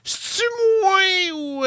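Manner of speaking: très haut, lancé en cri; voix aiguë criée. Acted. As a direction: crie l'intro comme un jingle. Rubrique annoncée, voix criée. (voix aiguë exagérée)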